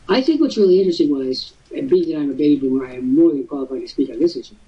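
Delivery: ordinary talk, nothing marked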